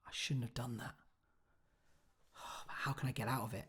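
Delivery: very soft, regretful inner thought; hushed regret. Acted. Character regretting something in a monologue, hushed regret. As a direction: sigh and speak barely aloud, full of regret. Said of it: "a moment where you regret something".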